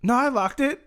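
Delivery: high, rising imitated insistence; hammed-up questioning protest, pitched high; mock doubtful protest. Acted husband, repeated after looking out.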